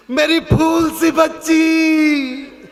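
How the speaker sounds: father crying at his daughter's farewell, stretched mock wail. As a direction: ham up a father's wail over his little girl. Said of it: "लड़की का बाप रो रहा".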